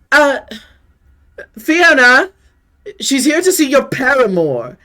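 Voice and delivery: high, loud servant's call at the door; servant announcing. Acted. Servant calling into the house for the lady. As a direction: announce the visitor brightly.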